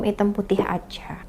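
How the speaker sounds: unvoiced whisper. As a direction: whisper the question. Reads message, voice drops to whisper.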